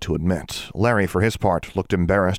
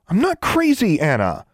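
very high, indignant denial; glaring protest; 12 semitones higher than usual. A narrator's reading. Girl glaring at her doubting friend, indignant. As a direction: protest high, then drop on the name. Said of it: "Alexandra glared at her".

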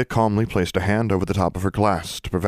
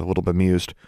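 plain reading voice

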